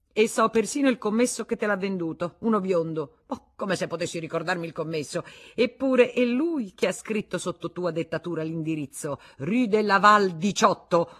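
high, loud, pointed; accusing. Acted. Wife laying out proof to her husband, accusing. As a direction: nail down every detail.

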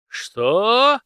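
loud, high outraged roar; an enraged master roaring at his servant; roaring what. A narrator's reading. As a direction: roar it back in disbelief. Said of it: "взревел Иван Фёдорович".

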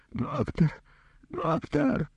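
high, thin pleading call; desperate calling. A narrator's reading. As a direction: call the doctor back, rising in despair. Abandoned patient calls doctor back. (отчаянно зовёт)

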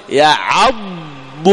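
extremely slow يعض, ending on a clear ض; isolated ض. Shown as a demonstration. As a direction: hold the ض of يعض. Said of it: "هذا الضاد".

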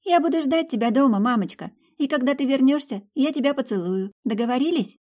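put-on high, gentle small girl's voice; little girl. A narrator's reading. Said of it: "ответила тихо".

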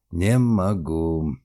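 slow, each word spaced out; measured, deliberate. A narrator's reading. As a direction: weigh every word. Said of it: "с расстановкой проговорил".

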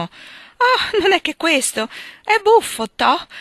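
high, loud amused exclamation; smiling surprise. A narrator's reading. Sally reacts to Meg's only dress, smiling and surprised. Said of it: "sorridente e sorpresa, esclamò".

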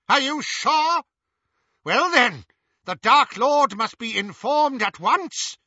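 very high, loud woman's voice; frantic, commanding, pitched right up; shrieking urgency. A narrator's reading. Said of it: "shrieked Bellatrix".

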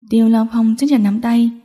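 ordinary delivery

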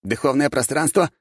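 high baffled rat voice; confused rat man. A narrator's reading. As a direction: ask lost and squeaky. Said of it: "растерялся парень".

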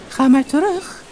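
hoog, licht sprookjesvisje; gouden visje. Acted. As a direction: een klein magisch visje. Het visje spreekt. (hoge visjesstem)

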